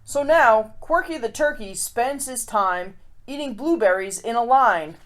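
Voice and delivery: lively rhyming read-aloud; storybook reading. A narrator's reading. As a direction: read it like a picture book to kids. Reads a rhyming picture book.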